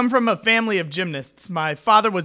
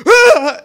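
short, high, loud yelp of terror; mock night-terror scream; 13 semitones higher, 10 dB louder than usual. Father waking from night terrors, high imitated scream. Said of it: "I would hear".

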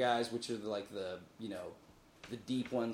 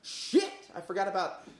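sudden realization; 7 semitones higher than usual. Retold or imitated. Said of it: "I'm just gonna go".

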